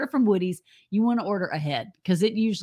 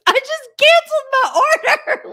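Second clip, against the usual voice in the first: imitated wail, much higher and louder, slow; dismayed shout; 7 dB louder than usual. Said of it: "She goes".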